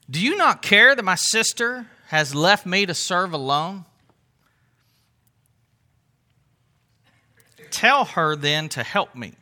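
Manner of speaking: mildly irritated whining to Jesus; a hint of a put-upon sister; irritated complaint. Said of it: "it might have sounded like this".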